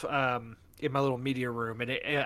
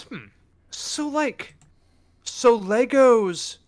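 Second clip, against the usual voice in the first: skeptical wife; 4 semitones higher, 5 dB louder than usual. Retold or imitated. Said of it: "My wife goes".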